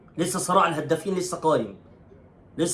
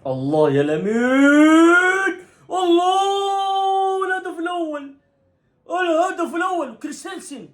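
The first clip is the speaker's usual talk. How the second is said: long drawn-out joyful goal yell, higher and louder; goal celebration; 11 semitones higher, 4 dB louder, much slower than usual. Real, not acted. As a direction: stretch the goal cry out in real delight.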